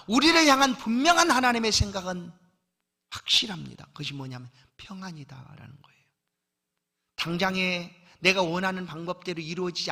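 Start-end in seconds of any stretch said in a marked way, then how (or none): 3.9-6.0 whisper. Acted. Sermon point revealed in hush.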